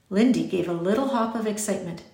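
plain reading voice

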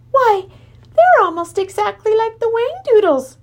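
high, loud burst of astonishment; surprised outburst. A narrator's reading. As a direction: blurt it out in amazement, pitch leaping up. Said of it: "gasped in surprise".

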